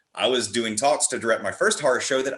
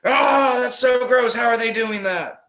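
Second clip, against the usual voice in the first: disgusted audience shout; 13 semitones higher, 7 dB louder, slower than usual. Retold or imitated. Audience reacting to gore.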